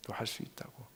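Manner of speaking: barely audible whisper of encouragement; very soft breath of encouragement; whispered reassurance. Retold or imitated. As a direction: whisper it as a private assurance.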